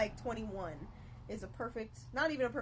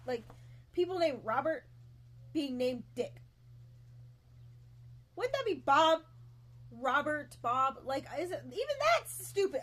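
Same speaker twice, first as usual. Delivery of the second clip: higher and louder, worked-up complaining; indignant rant; 6 semitones higher, 6 dB louder than usual. Real, not acted. Vlogger rants to viewers about silly nicknames, exasperated.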